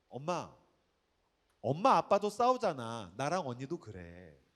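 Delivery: put-on slow, drawn-out kid's reasoning; lightly voiced child, stretching the words; imitated little daughter. Seven-year-old explaining fights to her mom, slow childlike delivery.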